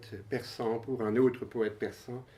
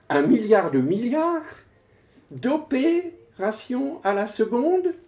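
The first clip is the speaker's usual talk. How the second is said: high, proclaimed figure with a pause inside; poem declamation; 13 semitones higher, 6 dB louder, slower than usual. Acted. Poet astounds the audience with operations per second, declaimed. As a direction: proclaim the enormous number in a raised voice.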